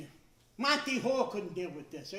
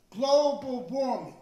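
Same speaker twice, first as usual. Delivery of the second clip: dramatic announcer; 3 semitones higher, 9 dB louder, much slower than usual. Retold or imitated. Dramatic TV title.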